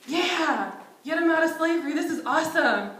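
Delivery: playfully imitated enthusiasm; excited Moses. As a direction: Moses thrilled at the plan. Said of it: "I imagine Moses is like".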